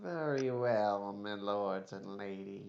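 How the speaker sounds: weaselly courtier. Acted. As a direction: draw out the deference, letting it drop away. Weaselly elf grudgingly obeys the party.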